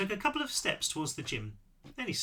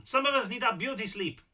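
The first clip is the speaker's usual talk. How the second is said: put-on high-pitched complaint in a character's borrowed accent; accidental character accent, raised pitch; Seth's accent; 7 semitones higher than usual. A narrator's reading. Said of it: "I did that in Seth's accent".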